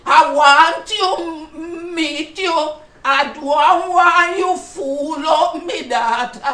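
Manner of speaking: put-on slow, loud old granny voice. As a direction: play a bossy grandmother on the phone. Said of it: "a granny voice".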